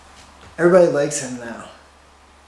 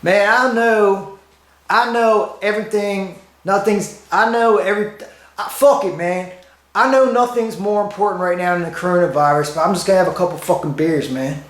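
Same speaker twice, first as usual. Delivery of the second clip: higher-pitched, exasperated Maryland local; Maryland accent impersonation; 4 semitones higher than usual. Acted. Maryland person excusing beers during the pandemic, local accent impersonation. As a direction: put on a Maryland accent, pleading the case. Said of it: "this is my impersonation of a Maryland person".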